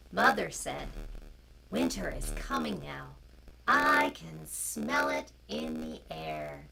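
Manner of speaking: loud, slow storybook reading; reading to children. A narrator's reading. Storyteller reading a picture book to children online. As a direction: read it out to the little ones, clearly.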